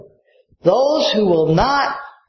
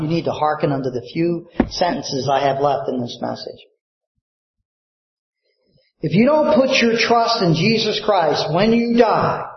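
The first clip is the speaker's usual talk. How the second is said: usual speaking voice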